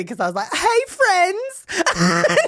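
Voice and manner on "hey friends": high, bright ironic hey friends; ironic greeting. Retold or imitated. Mocking camp counselors, ironic sing-song greeting. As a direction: say it with a wink. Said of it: "like, ironically".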